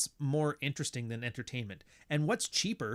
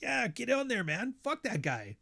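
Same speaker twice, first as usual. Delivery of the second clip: high eager cheering, then dropping; jokey high-pitched encouragement; mock cheer; 9 semitones higher than usual. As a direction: egg them on gleefully. Imitating himself cheering.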